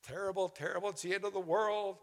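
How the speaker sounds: high mock panic on the Ah, then a mock-dramatic doom voice; mock alarmist media. Preacher mocking doom-laden media, mock panic. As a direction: ham up the alarmed newscaster. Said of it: "media is trying to get us like".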